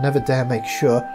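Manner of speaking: usual speaking voice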